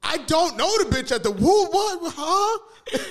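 very high, loud comic bewilderment; flabbergasted. Acted. Accused speaker baffled by the misquote, hammed-up confusion. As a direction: sputter squeaky questions in disbelief.

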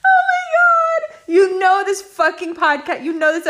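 high, squealing laugh-talk; laughing in embarrassment at her own confession. Real, not acted. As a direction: shriek it through laughter, mortified. Embarrassed at own toxic trait.

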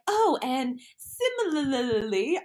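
slow, drawn out, mocking similarly; mock posh. Mocking a sentence she'd never say. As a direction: ham up a fancy similarly. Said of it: "I would never be like".

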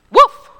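sharp high yelp of a woof; dog bark. A narrator's reading. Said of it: "barked the dog".

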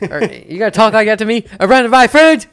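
high, loud, fast mock threat; hammed-up brawler picking a fight; mock tough guy. Teasing a friend, playing a tough guy, loud and fast.

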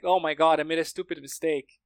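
ordinary talk, nothing marked

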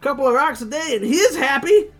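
high punk voice. Acted. Voicing Spikes in comic.

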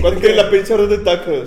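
mock Monterrey tough accent. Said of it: "el acento golpeado".

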